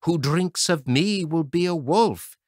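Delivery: a hint of an eerie brook voice; brook repeating. A narrator's reading. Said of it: "Sister heard it repeating".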